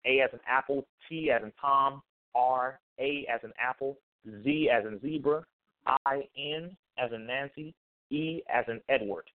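"A as in apple" spelled letter by letter, each with a rising tune; spelling it out. Shown as a demonstration. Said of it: "Can, can you repeat them for me?".

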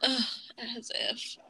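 whispered scorn. Real, not acted. Scornful aside.

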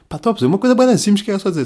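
fast, high exasperated rant; fast frustrated outburst. Real, not acted. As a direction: rush it out, annoyed at yourself. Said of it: "self-exasperated".

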